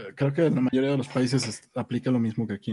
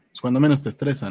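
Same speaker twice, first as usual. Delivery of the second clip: ordinary talk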